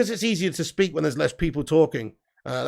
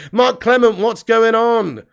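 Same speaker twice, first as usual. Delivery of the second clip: loud, high hail to a viewer; loud greeting; 4 semitones higher, 7 dB louder, slower than usual. Real, not acted. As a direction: call out big, stretching the last words. Calling out to a viewer.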